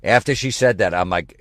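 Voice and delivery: normal talking voice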